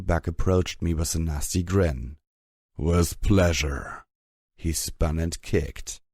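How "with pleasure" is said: slow, gloating brute voice. A narrator's reading. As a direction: savour it with a nasty grin. Brute with nasty grin.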